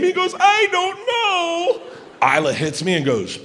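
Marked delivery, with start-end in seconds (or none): 0.4-1.8 put-on high, drawn-out little-kid wail; panicked little boy. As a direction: wail it slowly like a panicked child. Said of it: "he's still panicked".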